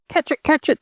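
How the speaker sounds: very high, eager chant of the chase; excited echo. Acted. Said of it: "the others echoed".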